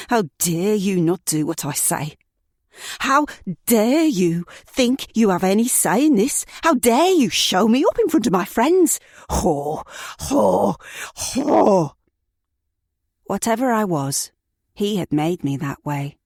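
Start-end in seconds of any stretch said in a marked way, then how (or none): 0.1-11.9 furious yelling. Acted. Abusive boyfriend screaming at his partner, furious rising yell. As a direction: build from a snarl to a yelling tirade.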